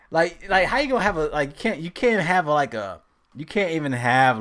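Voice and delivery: loud, high, stumbling protest; amused exasperation. Real, not acted. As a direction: blurt it out loudly in disbelief. Protesting nobody could say it straight-faced.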